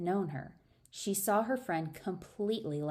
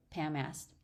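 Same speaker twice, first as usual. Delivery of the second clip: ordinary delivery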